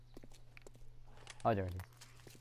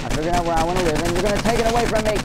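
fast, loud, high outraged yell; frantic angry rant; 8 semitones higher, 14 dB louder, much faster than usual. Real, not acted. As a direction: rant at them at full speed. Zombies attack his base.

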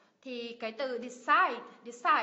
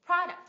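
PRO in "product" high and loud, then dropping; first-syllable stress; 4 semitones higher, 6 dB louder than usual. Shown as a demonstration. Teacher models product for learners, first syllable stressed. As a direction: hit the start of "product" hard. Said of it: "nhấn âm tiết thứ nhất".

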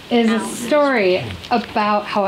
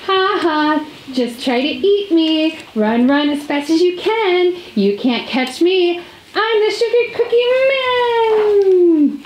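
taunting cookie; 7 semitones higher than usual. Acted. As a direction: taunt the fox from afar. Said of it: "cookie taunts the fox".

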